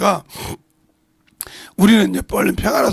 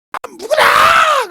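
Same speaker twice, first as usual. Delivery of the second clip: much higher, very slow, loud call; echoing valley yell; 8 dB louder, much slower than usual. Retold or imitated. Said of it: "골짜기 그러니까 울려 퍼져가지고".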